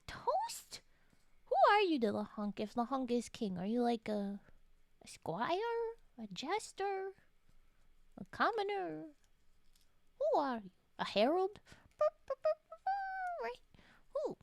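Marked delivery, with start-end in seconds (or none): 0.1-0.8 high rising question; startled call of the name; surprised Toast. Real, not acted. Startled by a chatter named Toast. 12.0-13.4 high playful burp noises; silly high burps. Pretend. Mimicking a herald with high burp noises.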